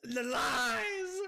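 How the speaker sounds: loud, slow wail of betrayal; anguished scream. Real, not acted. Streamer betrayed by a drink that wasn't water, screaming loud and slow. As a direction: howl it out like you've been wronged. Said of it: "That scream just made Summer jump".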